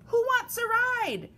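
high, cheery truck voice; friendly little truck. A narrator's reading. Blue offers friends a ride, high friendly call. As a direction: call out an eager invitation. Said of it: "said Blue".

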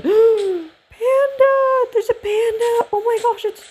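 real squeak of joy at a panda; squeaky excitement. Real, not acted. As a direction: voice cracking way up high in delight. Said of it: "Sorry, my voice just squeaked there".

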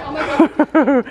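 loud laughing horse voice. Retold or imitated. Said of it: "My horse is like".

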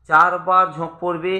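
ordinary delivery

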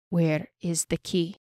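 slow, deliberate demand. A narrator's reading. Elf leans close to his captive, slowly demanding. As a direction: draw the question out with controlled menace. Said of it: "he demanded".

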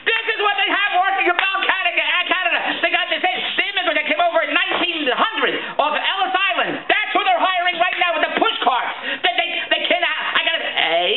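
high-pitched mocking tirade; exasperated rant. Customer railing about who Bell hires, exasperated. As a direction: rail in comic disbelief.

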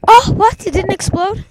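high, loud outraged surprise; shocked disbelief. Real, not acted. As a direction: react to the dud bird. Bird fails to explode.

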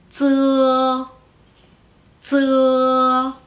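"z" as dz plus a long back uh vowel; Mandarin ZE. Shown as a demonstration. Teacher adding E to Z, "z" said as long level dzuh. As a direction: hold "z" as a level dz-uh. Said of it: "la E sola dijimos que se pronunciaba E".

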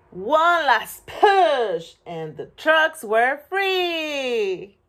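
high, loud, stretched-out words; excited climax. A narrator's reading. Reader cheering the trucks' last push, excited drawn-out narration. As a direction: heave it out slowly, then celebrate. Narrating the final heave and release.

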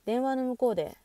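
ordinary delivery, nothing marked

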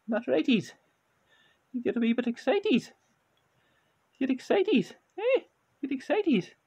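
high, loud coaxing to get him excited; high excited pet-talk. Shown as a demonstration. Owner coaxing his cat to get excited. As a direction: rev the cat up in a high coo. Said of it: "the change in the tone of the voice".

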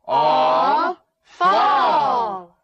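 plain reading voice

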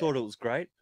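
ordinary talk

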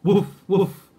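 loud barking woofs; dog barks. Acted. Hound Dog Blue agreeing, loud woofs. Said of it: "agreed old Hound Dog Blue".

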